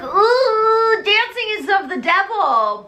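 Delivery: high, loud, gushing mock cry; hammed-up dramatic swoon; half-sobbing parody of a prom girl. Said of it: "she's like".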